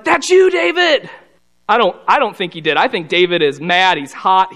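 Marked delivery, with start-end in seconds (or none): 0.0-1.1 high, drawn-out mock outburst; high-pitched gleeful "gotcha", acted out; jumping-up accusation. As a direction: imitate someone jumping up and down to accuse. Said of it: "jumped up and down and was like".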